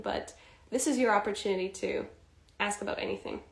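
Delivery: normal talking voice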